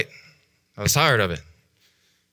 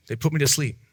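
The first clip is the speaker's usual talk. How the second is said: ordinary talk, nothing marked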